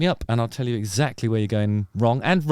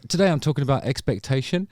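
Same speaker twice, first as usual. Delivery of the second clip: ordinary talk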